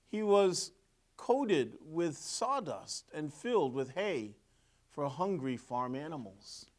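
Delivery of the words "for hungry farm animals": usual speaking voice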